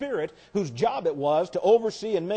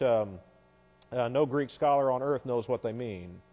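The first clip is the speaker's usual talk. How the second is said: normal talking voice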